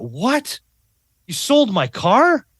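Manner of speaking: father to daughter, shocked his car was sold, rising alarm; alarmed disbelief. Retold or imitated. Stunned, rising panic. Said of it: "And he went".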